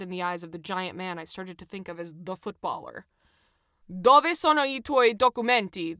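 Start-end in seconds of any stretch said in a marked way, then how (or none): 3.9-6.0 loud, high Italian bellow. A narrator's reading. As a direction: bellow the demand at the passengers. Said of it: "the footballer bellowed".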